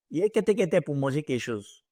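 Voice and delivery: Ivorian French street slang. Shown as a demonstration. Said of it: "That is the Ivorian French".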